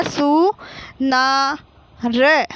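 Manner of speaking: slow सु, ना, र with pauses between; teacher holding each syllable of सुनार apart. Shown as a demonstration. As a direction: sound out सु ना र piece by piece. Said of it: "तो क्या हो गया?".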